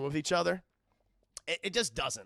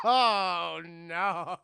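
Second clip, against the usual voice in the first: laughing groan; 8 dB louder than usual. Real, not acted. Laughing repeat of reaction.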